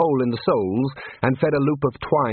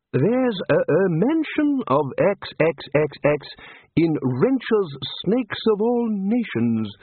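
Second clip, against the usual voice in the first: high, slow, prim bookish voice; drawn-out high voice of a scholar; fussy scholar; 8 semitones higher, slower than usual. A narrator's reading. Chair citing a snake book to colleagues, high and prim.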